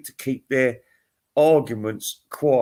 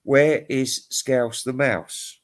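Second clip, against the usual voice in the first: slow plea; much slower than usual. Real, not acted. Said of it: "It must exist".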